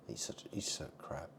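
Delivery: unvoiced whispered jab; hushed insult. Real, not acted. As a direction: whisper it as a snide aside.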